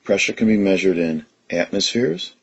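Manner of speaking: usual speaking voice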